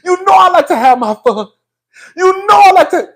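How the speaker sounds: high-pitched mock quote. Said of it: "Ain't that what [name] said?".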